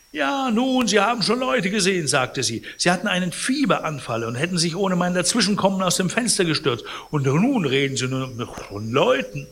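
high, fast nagging voice; scolding concierge. Acted. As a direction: talk him out of what he saw. Cibot scolding Pons.

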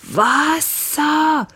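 high strangled croak for water; a gasping, high cry for water; rasping gasp. A narrator's reading. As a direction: choke out the word, throat burning. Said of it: "röchelte er".

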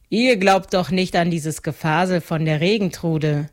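plain reading voice

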